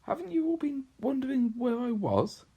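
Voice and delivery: put-on high, eager little-girl voice; a young girl bursting with news; girl's voice. Lucy to her siblings after returning, high and eager.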